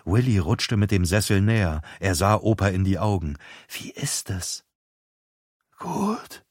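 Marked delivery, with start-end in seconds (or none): 3.7-4.6 soft hushed whisper. A narrator's reading. Boy whispering to dying grandpa. 5.8-6.4 a faint breathy word, barely voiced; breathed. A narrator's reading. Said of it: "hauchte Opa".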